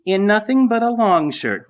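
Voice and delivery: mock-prissy echo of "nothing but a long shirt"; mocking mimicry. A narrator's reading. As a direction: parrot her words back in a mocking high voice. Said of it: "mimicking her".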